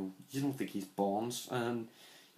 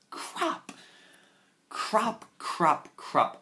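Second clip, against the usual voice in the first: frustrated repeated crap; 10 semitones higher, 5 dB louder, much slower than usual. Real, not acted. Frustrated outburst at Lazenby.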